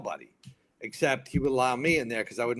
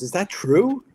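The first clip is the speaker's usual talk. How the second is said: high, louder question rising at the end; surprised question; 9 semitones higher, 7 dB louder than usual. Retold or imitated. Actress learns of the firing, surprised. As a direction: imitate her taken aback, pitched up.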